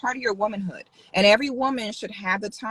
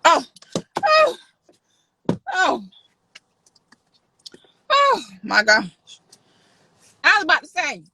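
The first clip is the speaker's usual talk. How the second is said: hammed-up gasping oh, high and louder; mock shock; 7 semitones higher, 6 dB louder than usual. Streamer acting shocked at a chat comment, hammed-up. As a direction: play up the fake shock.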